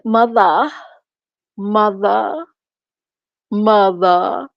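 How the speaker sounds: exaggerated English "mother", each one rising; mock English accent. Ridiculing his fake accent, mock English "mother". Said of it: "like he from England".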